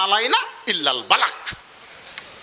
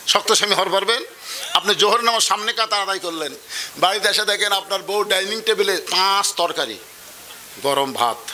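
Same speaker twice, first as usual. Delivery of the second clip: normal talking voice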